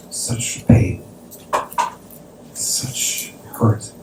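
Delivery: retold grief, drained to a whisper; hushed hurt. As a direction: voice the father's pain in a near-whisper. Father voicing deep grief, retold.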